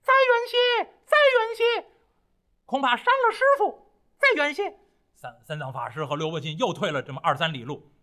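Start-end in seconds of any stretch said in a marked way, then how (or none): 0.0-4.8 high, loud monkey cry; monkey calling. A narrator's reading. Monkey calling his master from under the mountain, high and loud. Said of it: "猴子在远处喊".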